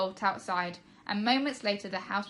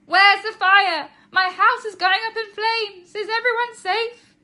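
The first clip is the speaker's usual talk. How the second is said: very high, much louder, slow frantic shrieks; panicked shouting; 12 semitones higher, 14 dB louder, slower than usual. Acted. Mum rushes home shrieking in panic about a fire. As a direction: scream it like the house is burning. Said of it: "making even more noise than the fire sirens".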